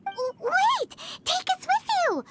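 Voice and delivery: eager plea. Acted. Begging to come along.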